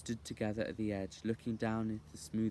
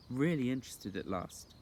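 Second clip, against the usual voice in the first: usual speaking voice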